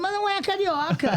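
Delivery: high, sharp "not carioca!"; indignant protest. Retold or imitated. Gaúcha actress protesting to her director, high and indignant. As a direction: insist it's not carioca, rising high. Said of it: "Eu falei".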